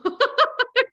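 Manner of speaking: high loud laugh; real laugh at her own punchline; burst of laughter. Real, not acted. Laughing at her own joke about the ceremony, loud laugh.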